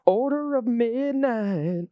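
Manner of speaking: high, drawn-out card title; theatrical announcement. Pretend. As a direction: intone it grandly, stretching each word. Card name repeated theatrically.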